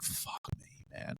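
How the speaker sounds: a whispered, voiceless curse under the breath; muttered curse. Retold or imitated. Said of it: "you're like".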